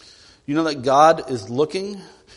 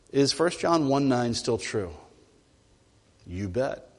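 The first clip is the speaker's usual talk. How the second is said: normal talking voice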